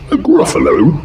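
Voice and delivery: high puzzled fox. Acted. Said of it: "fox puzzled".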